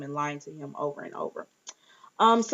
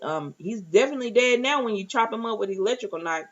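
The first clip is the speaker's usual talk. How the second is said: ordinary delivery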